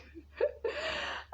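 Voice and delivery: high breathless laugh through tears; teary breathy laugh. Real, not acted. After admitting she cries, breathy teary laugh. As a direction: let out a soft teary laugh. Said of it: "I just start crying".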